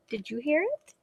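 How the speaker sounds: hushed storybook suspense; hushed dragon question. Acted. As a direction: ask softly, as if it might hear. Dragon asks again, hushed.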